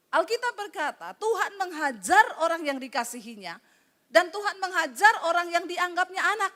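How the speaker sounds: ordinary talk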